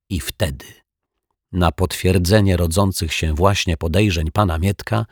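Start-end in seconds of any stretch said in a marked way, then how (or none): none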